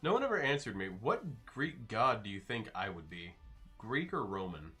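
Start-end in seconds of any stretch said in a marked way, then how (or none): none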